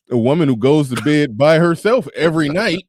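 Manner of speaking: ordinary talk, nothing marked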